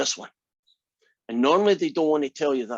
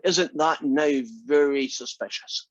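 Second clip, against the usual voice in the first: normal talking voice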